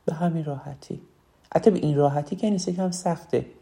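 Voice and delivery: ordinary talk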